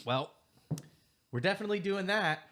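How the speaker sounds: high, loud, fast comic blurt; loud comic quip. Acted. NPC pipes up loudly.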